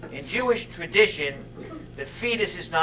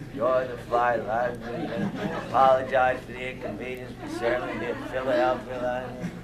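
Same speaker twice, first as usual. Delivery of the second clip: low, soft cockpit announcement voice; unbothered airline pilot; 6 semitones lower, 6 dB quieter than usual. Acted. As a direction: murmur it like a routine pilot announcement, as if nothing happened. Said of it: "like it didn't even happen".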